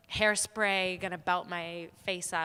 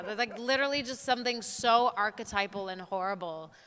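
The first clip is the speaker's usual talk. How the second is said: usual speaking voice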